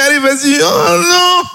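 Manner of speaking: high falsetto wail; caricatured frustrated wife; squabbling couple. Acted. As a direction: whine it in high-pitched dismay. Seat quarrel as plane lands.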